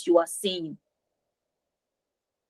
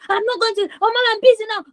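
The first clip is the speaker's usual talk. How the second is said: high, whiny refusal; mock screaming at mom; 10 semitones higher, faster than usual. Shown as a demonstration. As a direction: protest to mom in a high, exasperated child voice. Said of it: "instead of you screaming".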